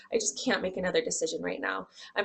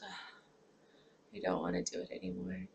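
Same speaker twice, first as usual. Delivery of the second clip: mild depressed; 11 dB quieter, faster than usual. Retold or imitated. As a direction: say it like you've given up, quietly. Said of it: "I can just become depressed".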